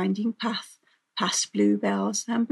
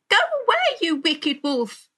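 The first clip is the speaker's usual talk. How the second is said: put-on high, loud little-girl protest; indignant high cry, easing down; 9 semitones higher, 5 dB louder than usual. A narrator's reading. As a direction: shoo the villain away, starting very high.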